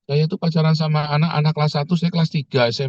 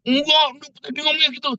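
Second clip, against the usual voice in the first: very high imitated protest; acted-out stubborn denial; mock insistence; 14 semitones higher than usual. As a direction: contradict in a hammed-up high voice. Imitated committee member disputing.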